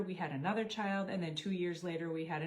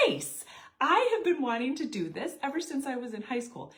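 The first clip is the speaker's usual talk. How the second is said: higher, louder eager reply; delighted; 5 semitones higher, 5 dB louder than usual. Retold or imitated. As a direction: gush with delight. Her delighted reaction.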